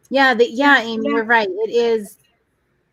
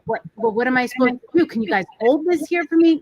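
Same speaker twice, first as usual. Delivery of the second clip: fast, then much higher and pleading; desperate plea; 5 semitones higher, 3 dB louder, faster than usual. Retold or imitated. To the guards, a mom desperate to get in, pleading. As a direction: beg them to make an exception. Begging staff to hold bag.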